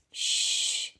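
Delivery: a soft unvoiced shh to quiet the room; hushing shh. Girl hushing the children with a whispered shh. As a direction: hush them with a breathy shh.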